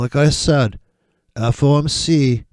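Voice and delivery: ordinary talk, nothing marked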